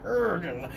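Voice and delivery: soft straining animal growl; short throaty dog noise; imitated dog growl. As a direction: growl like a dog pulling on its leash. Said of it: "The dog neck was like".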